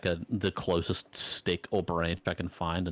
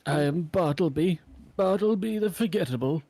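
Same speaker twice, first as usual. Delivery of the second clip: higher, self-mocking title; mock-grand hero; 8 semitones higher than usual. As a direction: announce yourself with a wink. Hero introduces himself grandly.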